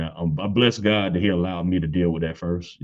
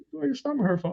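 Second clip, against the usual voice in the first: very high puzzled question; confused disbelief; baffled; 4 dB quieter, faster than usual. Retold or imitated. A father puzzled by his son's stomach ache, very high.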